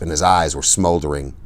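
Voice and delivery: ordinary delivery, nothing marked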